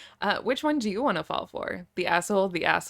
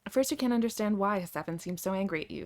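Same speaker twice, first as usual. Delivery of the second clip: ordinary delivery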